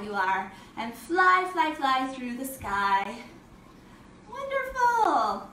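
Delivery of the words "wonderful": high, slow, drawn-out praise; delighted praise. Real, not acted. Teacher praising kids after butterfly pose, high delighted praise. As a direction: stretch it out high, then let it fall.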